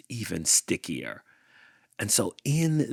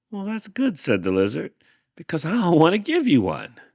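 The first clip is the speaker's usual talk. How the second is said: cheeky retort; 11 semitones higher, 6 dB louder, faster than usual. Acted. Lizard retorts to Hank.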